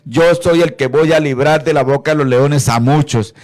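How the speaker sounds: más alto y más fuerte, con fervor de predicador; predicación fervorosa. Acted. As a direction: proclamarlo con fuerza a la congregación. Preaching with rising fervor. (el predicador proclama ante los hermanos, alto y fervoroso)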